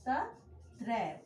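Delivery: तत्रैव stretched slowly, each syllable held; slow teacher demo of तत्रैव; drawn-out answer. Shown as a demonstration. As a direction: draw out तत्रैव for the class. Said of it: "तो यहाँ पे क्या हो जाएगा आपका?".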